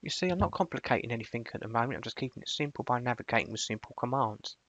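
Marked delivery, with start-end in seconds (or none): none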